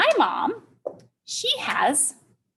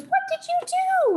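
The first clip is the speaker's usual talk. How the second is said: high-pitched, sing-out call; mock yelling. Mom yells up to child in tree, mock yell. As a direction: imitate a mother calling up high. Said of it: "she yells up to me".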